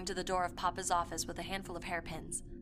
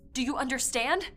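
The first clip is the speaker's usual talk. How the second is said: raised, louder, insistent question; urgent demand; 5 semitones higher, 7 dB louder than usual. Acted. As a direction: press them hard to understand. Narrator pleads with doubting listeners.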